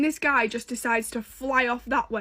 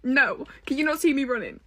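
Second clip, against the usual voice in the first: sassy brush-off building; 4 semitones higher than usual. Retold or imitated. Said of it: "And I'm like".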